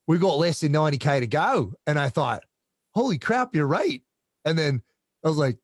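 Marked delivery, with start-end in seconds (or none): 0.1-1.7 imitation Australian accent, high and a bit loud; Aussie accent. Australian athlete urging on a fellow racer mid-race, imitated Aussie accent. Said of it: "with that accent". 2.9-4.0 high-pitched mock amazement; retold amazed realization. Racer realizing the other athlete is right, high mock amazement.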